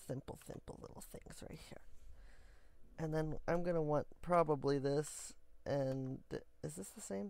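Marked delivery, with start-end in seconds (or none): 0.1-1.8 whispered repeat of simple, simple little things; breathy secret whisper, no voice. Shown as a demonstration. Creator whispering to viewers, hushed whisper.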